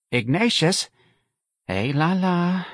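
high, lamenting sigh of words; a touch of motherly woe; sad. A narrator's reading. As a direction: lament over your hopeless son. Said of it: "Missus Riley said sadly".

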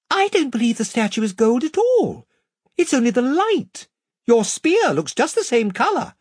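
a bright young girl voicing doubt. Lucy's voice, raised.

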